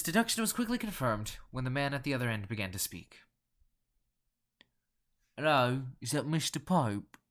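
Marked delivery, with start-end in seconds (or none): 5.4-7.2 slow, louder, guttural Cockney voice; guttural nasal Cockney. A narrator's reading. Londoner phoning a collector, in guttural nasal Cockney. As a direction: speak through the nose in thick Cockney. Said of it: "The guttural yet nasal Cockney accent".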